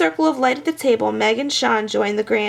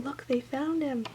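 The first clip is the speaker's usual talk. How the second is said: usual speaking voice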